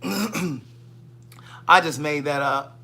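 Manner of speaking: very slow teasing drawl of I just made that up; slow drawn-out confession. Shown as a demonstration. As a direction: stretch each word of I just made that up out slowly.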